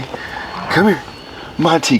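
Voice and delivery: usual speaking voice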